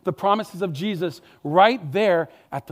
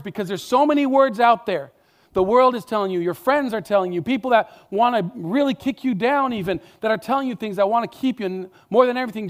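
higher, louder, faster fervent preaching; preacher rallying the congregation; building preaching; 4 semitones higher, 4 dB louder than usual. Real, not acted. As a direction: ramp up the urgency.

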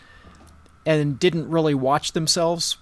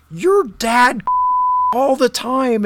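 higher, louder, indignant snap back; angry retort; 10 semitones higher, 6 dB louder, much slower than usual. Retold or imitated. Stepmom snaps at her stepson about his dad, mimicked angry voice. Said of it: "she got really mad at me".

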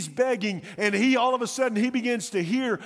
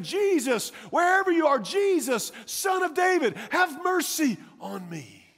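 high, searching call for help; acted pleading cry, pitch lifted; retold yell; 7 semitones higher than usual. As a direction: call out blindly in every direction. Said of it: "He's just turning circles saying".

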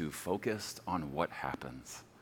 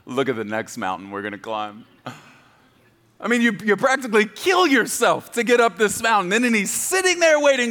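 loud, high, full-force preaching; 9 semitones higher, 12 dB louder than usual. Acted. Preaching to the congregation, loud and high. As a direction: preach it out to the church about arriving.

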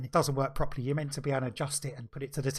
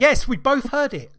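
emphatic yes; 8 semitones higher, 10 dB louder, slower than usual. Real, not acted. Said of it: "Did you both hear it".

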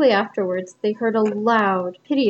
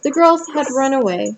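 ordinary delivery

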